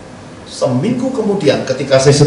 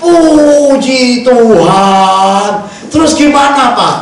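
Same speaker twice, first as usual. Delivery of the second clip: higher, drawn-out praise from the wife; wife's delighted reply; 6 semitones higher, 3 dB louder, much slower than usual. Retold or imitated. Wife hears the good news, stretched-out delighted praise. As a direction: stretch out puji like a thrilled wife. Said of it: "Istri saya".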